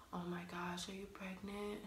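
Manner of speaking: near-whispered imitation of a stunned mom; hushed shock. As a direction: ask it under your breath, as a shocked mother. Voicing mom's stunned question.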